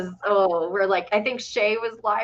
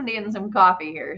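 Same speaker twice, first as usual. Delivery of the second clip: ordinary talk, nothing marked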